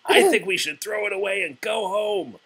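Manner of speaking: high, quick, fretful little Toad; a high, rushed plea to give up; Toad's whiny voice. Acted. Toad begging Frog to give up on the kite, high and whiny.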